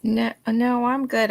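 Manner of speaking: ordinary talk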